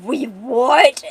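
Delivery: loud indignant outburst; mad. Acted. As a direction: burst out in mock outrage. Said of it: "Oh, she's mad".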